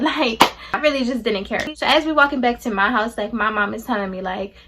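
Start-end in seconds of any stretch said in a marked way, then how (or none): none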